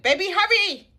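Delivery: high, loud shout of hurry; loud call. Real, not acted. Calling her partner to come join the video, loud shout. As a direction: yell it across the house.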